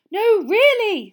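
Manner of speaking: high, loud, drawn-out plea; desperate. A narrator's reading. Mister Weasley to the panicking Dursleys, desperately. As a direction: insist desperately over the noise. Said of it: "said Mister Weasley desperately".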